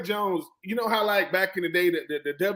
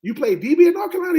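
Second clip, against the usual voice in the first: disbelieving; 8 semitones higher, 6 dB louder, faster than usual. Retold or imitated. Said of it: "the Batman Carroll goes".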